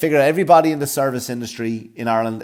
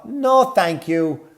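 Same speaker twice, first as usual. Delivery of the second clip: slow polite refusal; 9 semitones higher, 4 dB louder, much slower than usual. Retold or imitated.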